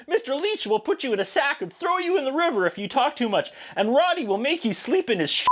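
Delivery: lightly voiced high kid tattling; a hint of a child snitching on grown-ups; a high, lightly put-on child voice. Voicing the kids bad-mouthing adopters.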